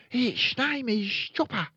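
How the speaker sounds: put-on high-pitched boy character; young Fred. A narrator's reading. Fred shows off his parrot.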